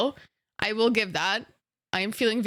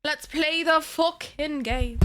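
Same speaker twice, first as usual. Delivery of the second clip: higher, slow, drawn-out mock British; hammed-up mock British accent; 5 semitones higher, slower than usual. Streamer rallying chat to start playing, hammed-up mock British accent. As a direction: stretch it out in exaggerated mock British. Exaggerated repeat of the line.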